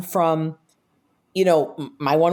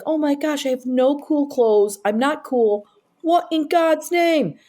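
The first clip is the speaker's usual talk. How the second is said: high, drawn-out comic fretting; mock panic; 9 semitones higher, slower than usual. New cast member starting immediately, comically panicked. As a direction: wail it in comic dismay, pitched up.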